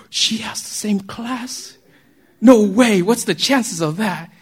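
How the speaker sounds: drawn-out, play-acted astonishment; hammed-up lovestruck disbelief; mock amazement. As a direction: act floored by a lucky coincidence, stretching the words. Acts amazed student seeing schedule.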